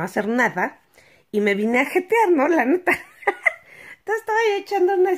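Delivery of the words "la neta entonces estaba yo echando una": giggling through the words; light laughing delivery. Real, not acted. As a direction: laugh as you say it. Laughing while telling nap.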